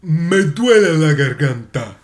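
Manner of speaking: slow, smooth, velvety imitation of a Spanish language CD narrator; deep velvety Spanish tape voice. Imitating a velvety Spanish class recording, slow and smooth. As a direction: croon it slowly like a silky language tape voice. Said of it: "his voice was very deep and very velvety".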